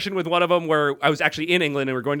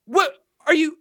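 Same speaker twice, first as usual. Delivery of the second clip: higher and higher disbelieving questions; mock incredulous rising to a laugh; 13 semitones higher than usual. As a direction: climb in pitch with each line of disbelief. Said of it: "I looked incredulous".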